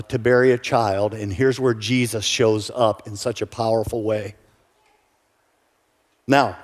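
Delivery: ordinary talk, nothing marked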